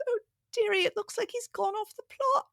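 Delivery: put-on high, lilting Irish accent of an old nurse; Irish nurse impression. Mother imitating the Irish lactation consultant, high Irish accent. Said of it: "she was Irish".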